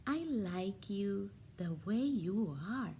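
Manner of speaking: put-on a girl comforting her new bear; tender. A narrator's reading.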